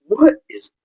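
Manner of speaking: high-pitched retold disbelief breaking into a laugh; incredulous, laughing. Buyer confronting the man after opening the dollar bill, incredulous. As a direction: blurt it high, tipping into laughter.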